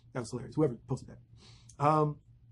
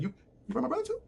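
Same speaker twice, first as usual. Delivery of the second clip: stunned disbelief; 9 semitones higher, 3 dB quieter than usual. Retold or imitated. Sam learns brother also burned.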